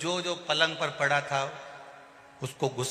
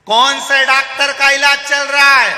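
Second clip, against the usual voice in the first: much higher, louder question; shouting; 11 semitones higher, 14 dB louder than usual. Retold or imitated. Deaf visitor asks about the doctor, shouting. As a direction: bellow the question at the bed.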